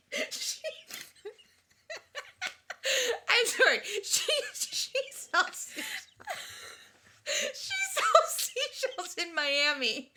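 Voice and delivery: helpless laughter, high and breaking through the words; can't stop giggling, squeaky and teary; laughing fit. Real, not acted. Host losing it over seashells, breaking into helpless teary giggles.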